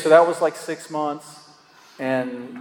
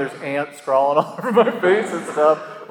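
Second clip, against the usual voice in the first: ordinary talk, nothing marked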